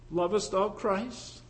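usual speaking voice

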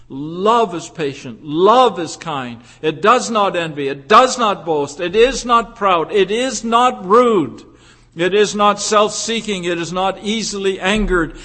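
loud, measured preaching cadence, each clause separate; forceful recital of scripture; emphatic scripture recitation. Acted. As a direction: drive each phrase home like a preacher.

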